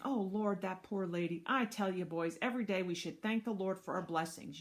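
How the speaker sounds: usual speaking voice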